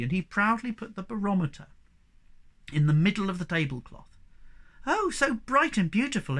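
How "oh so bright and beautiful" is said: high, delighted exclamation; exclaiming. A narrator's reading. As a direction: gush in admiration. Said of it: "exclaimed the Snork Maiden".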